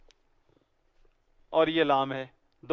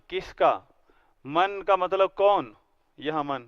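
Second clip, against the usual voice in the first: ordinary delivery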